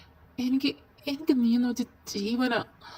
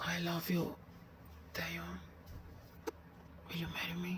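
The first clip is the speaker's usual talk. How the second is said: whispered proposal; 3 semitones lower, 17 dB quieter, much slower than usual. Acted.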